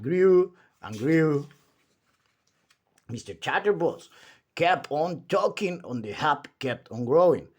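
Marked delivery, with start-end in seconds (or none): none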